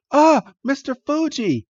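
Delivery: gasp, then very high cry; startled discovery. Acted. Friends finding Mister Fuji, startled.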